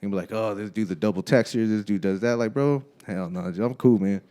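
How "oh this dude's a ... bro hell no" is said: usual speaking voice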